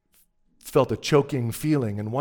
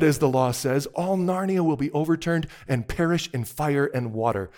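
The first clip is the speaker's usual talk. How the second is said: loud, raised, threatening witch; 3 semitones higher, 6 dB louder than usual. A narrator's reading. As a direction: declaim the threat with scorn. Plays the scornful witch threatening doom.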